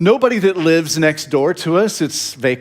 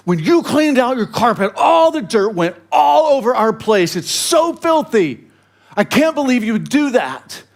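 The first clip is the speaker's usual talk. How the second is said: Betty voiced higher, in mock outrage; fuming Betty; 8 semitones higher than usual. Pastor imitating angry neighbor Betty, mock fury. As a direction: imitate the furious neighbor, pitched up. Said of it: "she's fuming, she's mad".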